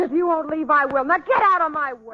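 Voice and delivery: furious outburst. Acted. Dancer storming out past her partner, furious shout. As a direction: push past him, shouting angrily.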